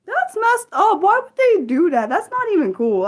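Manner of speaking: high-pitched outraged complaint; indignant. Real, not acted. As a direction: protest the unfairness, voice jumping up. Learns show is unavailable abroad.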